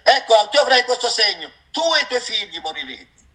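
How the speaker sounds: high, ringing sermon delivery, pressing every phrase; preaching at full force. Real, not acted. Preacher warning the congregation about God's word, high and forceful. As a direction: thunder the warning at the brothers and sisters.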